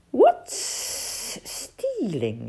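Sid, hissing. Acted. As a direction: draw out every S, puzzled snake. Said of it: "hissed Sid".

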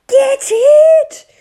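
high, loud panicked shout. Acted. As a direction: yell at them to grab the wasp. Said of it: "shouted Captain Beaky".

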